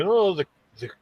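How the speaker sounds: mock Austrian explainer voice; German accent. Guy explaining tools in a German accent. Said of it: "the German or Austrian accent".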